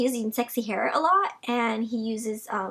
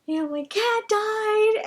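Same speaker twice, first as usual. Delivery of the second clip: mock sad whine; 11 semitones higher, 5 dB louder, slower than usual. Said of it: "I'm like".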